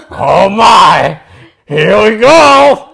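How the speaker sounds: very high, loud, slow troll; excited troll. Acted. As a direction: squeal with hungry delight. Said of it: "The troll began drooling".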